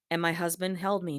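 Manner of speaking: ordinary talk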